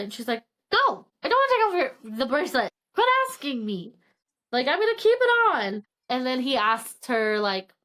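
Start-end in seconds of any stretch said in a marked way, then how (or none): 0.7-5.8 higher-pitched mock exasperation; retold indignant refusal. Girlfriend refusing to take off the bracelet, exasperated. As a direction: push back emphatically, as if retelling a squabble.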